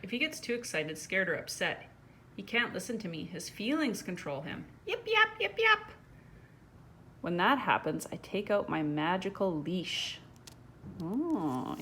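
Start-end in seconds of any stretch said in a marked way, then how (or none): 4.9-5.9 high, yappy little barks; yapping puppy. Acted. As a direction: yap like an overwhelmed puppy.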